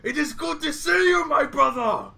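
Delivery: overjoyed greeting. Acted. Ogre hugs his clan brother, loud and overjoyed. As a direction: cry out your gladness while shaking him.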